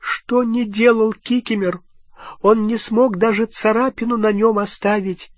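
high, groaning elf voice; a wretched elf lamenting; tearful elf, pitched far up; moaning house-elf. A narrator's reading. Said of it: "простонал эльф".

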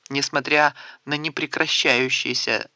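plain reading voice

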